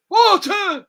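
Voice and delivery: a hammed-up shout; proclaimed. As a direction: announce it loud like a grand reveal. Shouted ingredient in reading.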